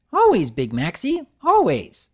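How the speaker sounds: high, eager, bouncy pony voice; bubbly Pinkie voice. Acted. Pinkie inviting Big Mac upstairs, high and bubbly. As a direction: chirp it up high like an excited party pony.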